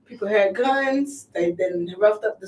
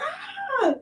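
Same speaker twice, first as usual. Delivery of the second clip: mock mother's gasp; 5 dB quieter than usual. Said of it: "she's like".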